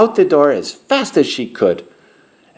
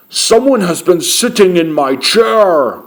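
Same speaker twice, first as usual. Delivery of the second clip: big bear voice, raised and forceful; roaring Papa Bear; 4 semitones higher, 4 dB louder than usual. A narrator's reading. Papa Bear notices someone used his chair, roaring. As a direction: roar it as a great big bear. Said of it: "roared Papa Bear in his great big voice".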